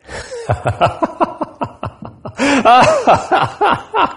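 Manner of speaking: a big, high, ringing laugh; hearty laugh. Acted. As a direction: laugh out loud with real delight. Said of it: "ein herzliches Lachen zu hören".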